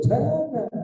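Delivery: sharp, loud, high forbidding "Jangan"; emphatic no. Real, not acted. Preacher warning husbands, a loud emphatic no. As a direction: cut it off firmly like a warning.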